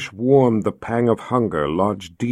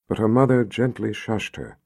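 plain reading voice

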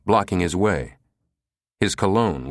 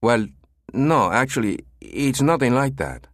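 higher, awkward nasal denial; embarrassed; 5 semitones higher than usual. Acted. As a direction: squirm a little as you deny it. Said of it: "The voice was obviously embarrassed".

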